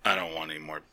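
put-on low, quiet drunken mumble; imitating a drunk. Drunk friend refusing another drink, low and subdued. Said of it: "He's so drunk, he goes".

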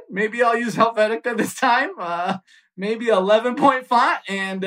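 high timid backpedalling, half laughing; terrified teen placating his father, high and timid; meek scared son. Retold or imitated. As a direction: squeak out a meek retreat to dad. Meek son backing down to dad.

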